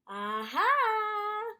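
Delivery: high, loud gotcha; triumphant aha. Acted. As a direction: catch him out. Narrator gotcha.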